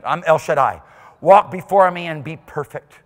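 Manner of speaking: loud, commanding divine voice; voice of God. Acted. God declaring himself to Abraham, commanding. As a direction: proclaim as the Almighty. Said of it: "That's what I mean".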